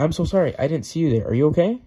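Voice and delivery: earnest read-aloud sorry; concerned apology. Acted. John apologizes after bumping into Mary.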